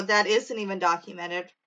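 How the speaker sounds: normal talking voice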